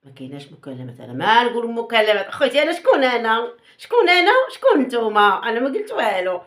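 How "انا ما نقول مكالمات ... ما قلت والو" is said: loud, higher-pitched feigned innocence; hammed-up indignant denial; mock protest. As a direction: deny it loudly, playing the innocent. Mock denial of having said anything.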